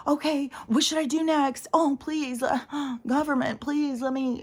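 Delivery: high, whiny mock plea to the government; mock pleading. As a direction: ham up a helpless, begging citizen. Mocking people who submit.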